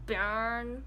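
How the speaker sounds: "Bern" as pern, German style; German pronunciation. Shown as a demonstration. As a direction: say "Bern" the German way, with a hard P-like start and rolled r. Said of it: "or in the German pronunciation".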